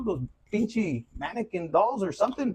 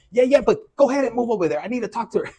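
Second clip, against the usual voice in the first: pushy suitor; 4 semitones higher, 6 dB louder, faster than usual. Retold or imitated. Acted suitor brushing boyfriend aside.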